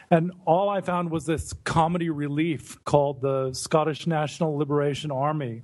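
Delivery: usual speaking voice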